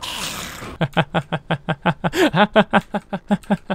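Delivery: drawn-out giggling fit; long gleeful laugh. Real, not acted. Player laughing after running people down, long laugh. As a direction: laugh it out at your own mischief.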